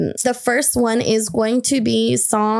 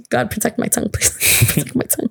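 laughing whisper; faster than usual. Real, not acted. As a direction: giggle it under your breath as a prayer. Holding back a rant, laughing.

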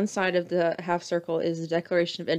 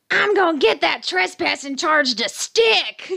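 higher, louder, slow mocking yell; 8 semitones higher, 6 dB louder, slower than usual. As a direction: imitate her yelling it out slowly. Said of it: "just yelling at the people".

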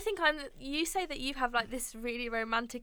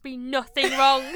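usual speaking voice

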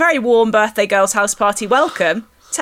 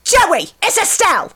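mock New York agent, pitched up high; higher, brassy New York impression; New York accent; 9 semitones higher than usual. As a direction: do Estelle calling Joey, broad New York. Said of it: "The way that I always try and do it is".

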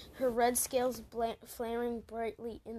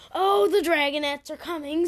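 a full-throated roar, much louder; bellowed; 5 semitones higher, 15 dB louder than usual. A narrator's reading. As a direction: bellow it to drown them out. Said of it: "Tsunami bellowed".

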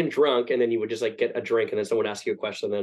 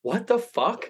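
mock disbelief; 10 semitones higher, slower than usual. Kitchen staff reaction.

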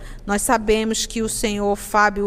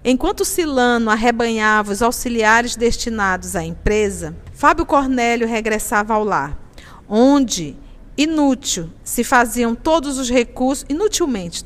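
louder storytelling read; dramatic narration; 5 dB louder than usual. A narrator's reading. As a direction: read the novel aloud with drama. Reads novel narration, louder.

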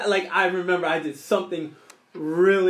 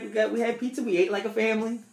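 ordinary delivery

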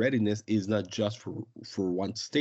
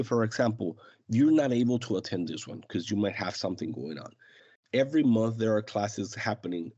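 normal talking voice

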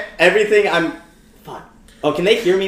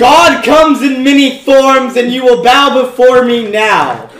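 slow, loud, higher divine threat; booming demon lord; 6 semitones higher, 6 dB louder, slower than usual. Acted. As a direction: boom out a final warning to mortals. Player threatens the goblins as a god.

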